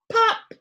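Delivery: high loud pop; popping sound. Acted. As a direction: pop it out like a bubble. Popping noise from spots.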